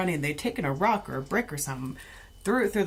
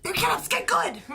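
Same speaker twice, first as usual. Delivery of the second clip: much higher, fast eager urging; excited wake-up call; 13 semitones higher, faster than usual. Retold or imitated. Waking husband to watch the fight, high fast excitement. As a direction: hurry him up, thrilled. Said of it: "trying to wake up my husband".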